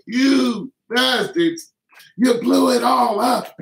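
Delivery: high, loud, slow outraged yell; hammed-up fury, high and loud; mock furious yell. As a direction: rage at the money men, hammed up. Yelling at league bosses.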